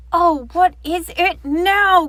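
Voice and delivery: loud, high, fed-up groan; exasperated. Acted. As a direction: sound utterly fed up. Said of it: "Grumpy Badger sighed".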